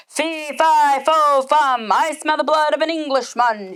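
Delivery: loud, menacing giant chant; giant's voice. Acted. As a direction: boom it out like a giant. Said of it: "he said".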